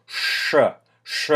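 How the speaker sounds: Sh dark and hard, lips pursed a lot; dark rounded sh. Shown as a demonstration. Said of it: "in Russian it's very hard".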